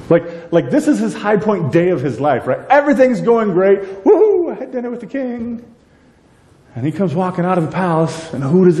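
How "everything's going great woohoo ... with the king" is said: elated Haman. Retold or imitated. Haman's elation voiced.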